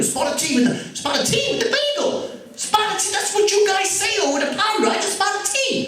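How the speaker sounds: put-on very high, frantic English accent slipping into New York; New York breaking through. Flustered shop owner carrying on, New York accent breaking through. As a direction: lose confidence as the fake accent collapses into New York. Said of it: "the New York accent started to break through".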